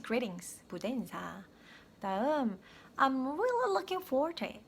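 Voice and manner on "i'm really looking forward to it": eager anticipation, pitched up high; with feeling. Shown as a demonstration. Teacher modelling emotion for learners, expressive anticipation. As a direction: lift REALLY with keen feeling. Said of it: "감정이랑 같이 표현해 주시면".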